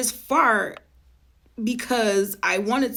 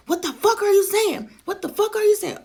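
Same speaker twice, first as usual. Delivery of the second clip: high, loud, furious demand; angry yell; 9 semitones higher, 3 dB louder than usual. Retold or imitated. Cousin yelling at her in the car, high and angry. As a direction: shout it back at her.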